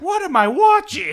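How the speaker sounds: very high, loud viewer shriek; high-pitched mock disbelief, shouted; mock baffled outcry. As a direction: cry out in hammed-up bewilderment. Acts out baffled viewers of channel.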